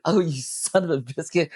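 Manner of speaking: hammed-up exasperation; mock curse. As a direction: spit it out at an absent God, half laughing. Cursing God in the retold scene.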